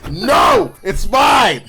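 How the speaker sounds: high, loud possessive cry; playful high-pitched yell; mock shout. Acted cry while blocking the bullet.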